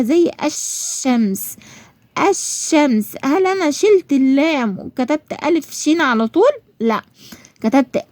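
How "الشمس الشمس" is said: الشمس slowly with a long doubled sh, no L; silent lam. Shown as a demonstration. Teacher showing a written but unspoken lam, long sh in الشمس. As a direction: drop the L and lean on the sh in الشمس. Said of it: "هي مش بنطقها بس بكتبها".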